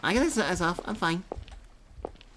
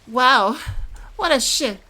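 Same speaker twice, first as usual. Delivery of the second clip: loud, high burst of surprise; 9 semitones higher, 8 dB louder, slower than usual. Real, not acted. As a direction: shout it out, caught off guard. Reacting loudly to game scene.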